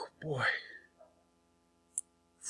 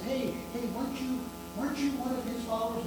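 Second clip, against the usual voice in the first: higher, quicker prying voice; accusing bystander; 7 semitones higher, 13 dB quieter, faster than usual. Retold or imitated. A bystander at the fire challenging Peter, prying. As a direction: needle him, suspicious and quick.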